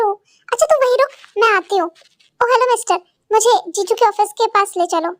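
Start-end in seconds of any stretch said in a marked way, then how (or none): none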